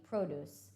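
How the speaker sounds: produce said PROH-dooce, stress on the first syllable; noun produce. Shown as a demonstration. Teacher contrasting the noun for students, PROH-dooce. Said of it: "which is a noun".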